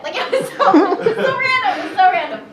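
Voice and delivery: high giggly disbelief; laughing. Real, not acted. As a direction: laugh through the words. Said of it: "laughing at memory".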